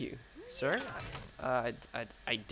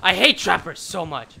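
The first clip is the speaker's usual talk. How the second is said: angry rant; 3 semitones higher, 7 dB louder than usual. Real, not acted. Ranting after dying to traps.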